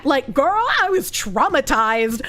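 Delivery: put-on melodramatic Valley girl with swooping pitch; hammed-up, traumatized Valley girl. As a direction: play a Valley girl recounting a horror story. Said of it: "replicate Kirsch's Valley Girl voice".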